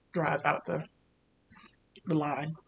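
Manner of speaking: ordinary talk, nothing marked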